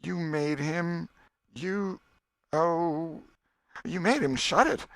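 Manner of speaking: a boy pretending to recall a dream slowly; boy Tom voiced. Tom recounts his dream.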